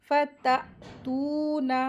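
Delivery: फततूना with doubled t and long held oo; measured recitation. Shown as a demonstration. Teacher models word, jolted double t, long oo in फततूना. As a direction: stop on the t of फततूना, then stretch oo. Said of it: "इसको दो हरकत खींचेंगे".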